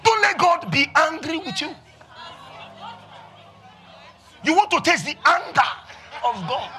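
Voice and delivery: high, mock threatening sermon; hammed-up pulpit threat; wrath preacher impression. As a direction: scold the flock like a doom preacher. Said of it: "they say it with their face like armpit".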